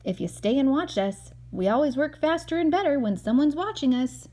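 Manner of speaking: lightly voiced proud workman; friendly Mike. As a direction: invite the boy to watch. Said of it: "said Mike".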